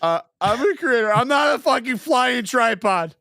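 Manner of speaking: mock rant. Mock rant about printing ducks.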